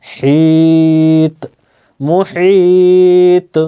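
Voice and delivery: মুহিত drawn long and chanted, the final ত echoing; qalqalah on ta. Shown as a demonstration. Teacher demonstrates the word for learners, chanted and slow. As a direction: recite মুহিত slowly with a bouncing ত. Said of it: "ত এর উপরে জজম বা সুকুন রয়েছে".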